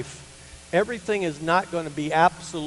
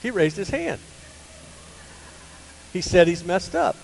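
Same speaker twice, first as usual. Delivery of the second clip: ordinary talk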